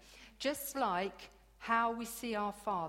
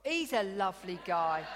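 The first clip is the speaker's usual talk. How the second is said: loud laughing praise; laughing shout; 8 dB louder than usual. Real, not acted. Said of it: "laughing shout".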